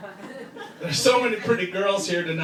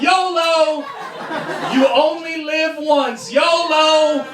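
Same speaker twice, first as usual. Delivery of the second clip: high, loud daredevil holler; mock yell; 9 semitones higher, 4 dB louder, much slower than usual. Kid before a stupid YouTube stunt, high mock yell. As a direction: holler it out, stretching the words. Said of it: "He yells out".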